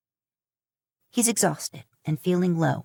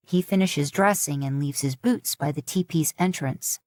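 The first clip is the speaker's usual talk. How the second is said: ordinary delivery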